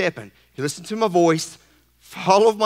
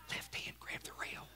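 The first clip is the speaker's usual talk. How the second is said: unvoiced whisper; 29 dB quieter than usual. God's voice guiding Drew to the rail, whisper. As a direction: breathe the instruction softly.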